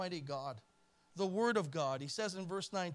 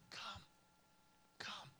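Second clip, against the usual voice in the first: hushed, breathed invitation, much softer; soft whisper; 18 dB quieter than usual. Acted. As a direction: whisper it like a tender call. Voicing God's quiet invitation.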